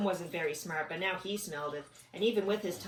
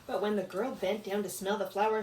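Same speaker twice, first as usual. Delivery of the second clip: ordinary delivery, nothing marked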